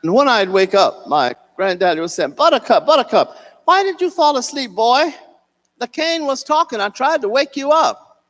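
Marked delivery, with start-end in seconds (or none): 2.3-8.2 put-on high, louder teasing call of an old man; playful elder calling a sleepy child; grandfather waking the boy.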